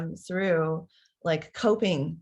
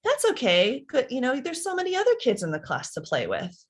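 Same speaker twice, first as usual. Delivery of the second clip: quick brush-off; 7 semitones higher than usual. Retold or imitated. Parent rushing to fix the child's friend trouble, breezy and high. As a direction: hurry to wave the problem away. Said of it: "you immediately go".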